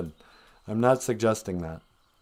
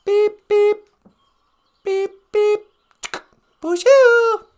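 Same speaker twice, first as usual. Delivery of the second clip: high robot beeps; 7 dB louder, slower than usual. Pretend.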